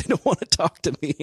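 high, loud mock wail; whiny high-pitched caller impression; mock drunken whining. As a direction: imitate an emotional drunk caller, hammed up. Imitating a drunk upset caller.

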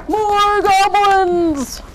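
high, loud, hugely drawn-out cheer; jubilant announcement. A narrator's reading. Goblins in the cave reveal themselves, long loud cheer. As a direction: stretch it out in a big reveal.

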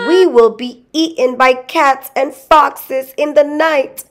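put-on high, whiny little-boy wail; crying boy. A narrator's reading. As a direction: whimper it high like a frightened child. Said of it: "the boys began to cry".

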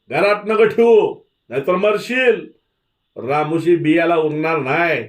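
very high, loud threats; shouting crowd. A narrator's reading. Village threatening Dhanaji if he keeps Avdi, high shouted threats. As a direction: yell warnings like an angry mob. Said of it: "गाव पुन्हा खोडून ओरडू लागला".

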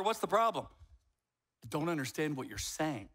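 ordinary delivery

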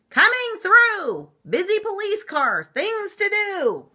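very high, loud police-car call; siren voice. A narrator's reading. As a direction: sing out like a wailing siren. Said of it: "went a siren".

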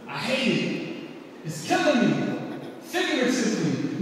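rhythmic slam poetry delivery, building in bursts; spoken word poem. Acted. Son performing a poem for his mom, rhythmic and impassioned. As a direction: perform it like a slam poet pleading.

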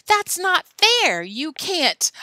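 high, slow wail of protest; loud protest. Acted. Alan objects to Billy eating it plain, loud protest. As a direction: object loudly, drawing the words out.

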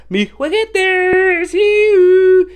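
squeaky, excited high pitch. Real, not acted. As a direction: pitch way up with delight. Showing off his toy.

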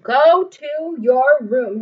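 loud, slow sending-off; stern mom. Acted. Mom sending David to his room. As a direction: order him away. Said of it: "his mom says".

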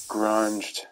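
ordinary talk, nothing marked

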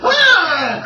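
high-pitched, drawn-out mock scream; hammed-up scream of a falling man; Wilhelm scream. Game master voices the archer plunging to the ground, Wilhelm scream. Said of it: "Does a Wilhelm scream".

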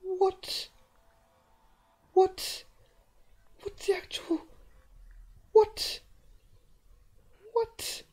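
very high, loud squeaking disbelief; shrieked what. Real, not acted. Player shrieking at the bloody poem, high squeals. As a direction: squeal each what in shock.

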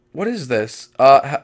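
ordinary talk, nothing marked

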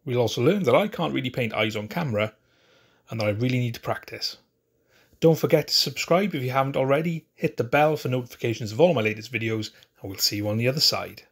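loud, upbeat YouTube intro; energetic video intro. Acted. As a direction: pump up the opening for viewers. Channel intro to camera.

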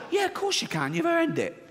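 fake-sweet, as if handing out a prize; mock sarcasm. Comedian mocking a passenger with fake-sweet approval.

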